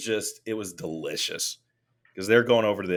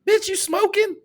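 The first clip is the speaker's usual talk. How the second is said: shrieked high and loud in mock disbelief; hammed-up outraged disbelief; incredulous; 7 dB louder than usual. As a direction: squeal the question as if you can't believe it. Mocking a fan's school claim.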